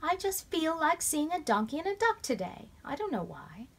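put-on high, childlike wistful voice; a small girl who can't explain it; little girl voice. A narrator's reading. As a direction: sister explaining her odd wish. Sister explains her wish.

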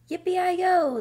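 high, loud whoop; cowboy shout. A narrator's reading. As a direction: whoop it like a rider at full gallop. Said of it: "the little man shouted".

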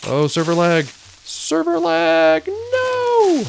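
high drawn-out wail over lag; anguished cry. Real, not acted. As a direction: wail it as everything goes wrong. Dying to lag, cries out.

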